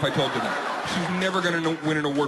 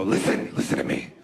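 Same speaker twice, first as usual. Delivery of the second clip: mock creepy caller; 10 semitones lower than usual. Prank caller menacing the wife.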